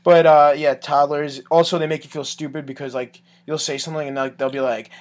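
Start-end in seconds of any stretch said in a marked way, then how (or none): none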